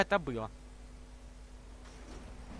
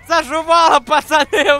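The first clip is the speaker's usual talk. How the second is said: shout; 4 semitones higher, 9 dB louder than usual. Real, not acted. Calling out to the guys.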